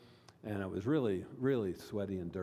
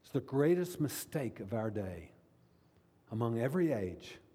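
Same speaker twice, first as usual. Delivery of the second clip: normal talking voice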